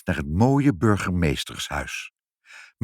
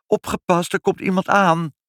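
high, loud warning; child calling out; 12 semitones higher, 5 dB louder than usual. A narrator's reading. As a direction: call out the alarm like a child. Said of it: "riep Ibbeltje". (waarschuwend roepend, hoog en luid)

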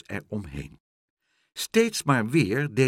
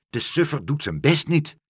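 louder cross complaint; angry heron call; 6 semitones higher, 5 dB louder than usual. Acted. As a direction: grumble loudly about the lazy bird. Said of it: "riep de reiger nijdig".

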